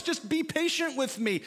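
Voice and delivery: mock groveling servant pleads with the king; mock pleading. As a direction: beg like a cornered debtor, overdone. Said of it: "doesn't that just sound noble".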